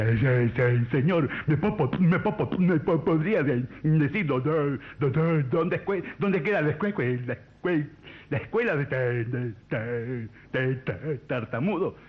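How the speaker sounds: heavy comic stammer on first syllables; stutterer. Acted. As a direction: get stuck and push through each word. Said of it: "un tartamudo encuentra a un señor en la calle y dice". (tartamudeo cómico exagerado)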